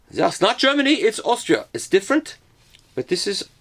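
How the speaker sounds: high-pitched put-on Austrian protest; mock Austrian accent. Mock Austrian objecting to mix-up.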